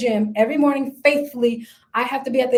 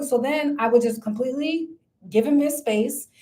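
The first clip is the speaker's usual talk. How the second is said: ordinary talk, nothing marked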